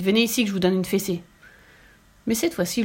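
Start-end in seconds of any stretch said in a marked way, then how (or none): none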